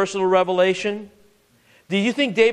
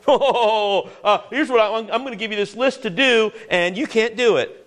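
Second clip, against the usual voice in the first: higher-pitched mock taskmaster, laughing at first; mocking God; 4 semitones higher than usual. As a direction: mockingly hand down an impossible order. Mocking a false idea of God.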